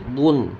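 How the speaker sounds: بن said as a heavy emphatic "dun"; thick, full d opening بن, said dun; emphatic Arabic daad in بن; full-mouthed daad. Shown as a demonstration. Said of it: "ضاد کو پور پڑھیں گے".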